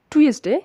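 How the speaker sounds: "টিউসডে" said as "tu-es-de", short ending; common mispronunciation. Shown as a demonstration. Teacher showing what everyone normally says, everyday mispronunciation. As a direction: say "টিউসডে" like "too-ES-de". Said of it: "আমরা আর কখনো বলবো না".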